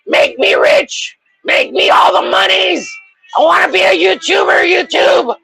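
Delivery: high, shouted begging to YouTube; over-the-top pleading love declaration; mock pleading. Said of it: "And then you go".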